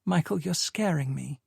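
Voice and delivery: softened voice. A narrator's reading. Sadie reaching out to Michael, softened voice. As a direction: soften and show the fear. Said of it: "Her voice softened".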